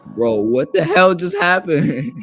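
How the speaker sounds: loud, slow stunned shout; can't believe what just happened; shocked disbelief. Real, not acted. Shocked, louder repeat.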